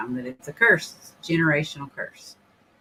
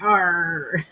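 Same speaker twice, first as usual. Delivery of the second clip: pirate arr. Retold or imitated. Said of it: "Like she was a pirate".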